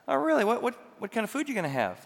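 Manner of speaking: high, teasing "Oh, really?" with fake curiosity; mock surprise. Son quizzing mom about lunch, in mock high surprise. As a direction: pretend to be amazed, pitch up.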